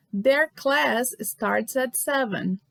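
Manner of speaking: slow, measured example reading; slow modelling. Shown as a demonstration. Teacher reads the last example to the class, slow modelling. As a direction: give each word room for learners.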